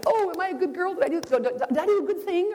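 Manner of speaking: put-on fishing for praise like a child; silly little girl. [name] teasing the pastor with a silly little-girl voice. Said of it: "being kind of silly, I clapped my hands".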